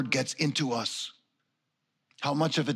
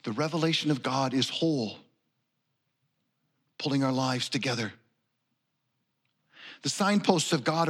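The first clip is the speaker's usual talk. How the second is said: scripture reading. Acted. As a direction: read each line as a treasured gift. Rereads the scripture text aloud.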